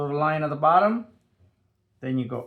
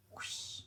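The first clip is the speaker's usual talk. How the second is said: whispered whoosh; 22 dB quieter than usual. Acted. Sound effect while drawing stem.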